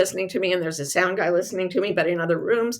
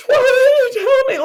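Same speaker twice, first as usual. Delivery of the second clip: high, loud mock wail; dismayed wail; 9 dB louder than usual. Author protesting to the director, high anguished wail. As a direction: squeal it in exaggerated dismay.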